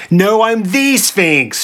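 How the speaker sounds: high, drawn-out cry, "the" stressed as "thee"; slow, high-pitched protest leaning hard on "the"; indignant scream. A narrator's reading. Sphinx corrects Oedipus about her title, indignant scream. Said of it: "she screamed".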